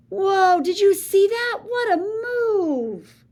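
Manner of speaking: high, loud excited cheering. Acted. Cheese cheers for his friend Wedge, high and thrilled. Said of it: "He's cheering on Wedge".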